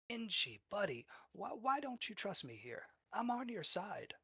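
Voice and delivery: high-pitched, wheedling goblin voice; high, chummy goblin coaxing for trust; friendly goblin. Acted. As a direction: plead like a buddy, voice pitched well up. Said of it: "you hear that friendly voice".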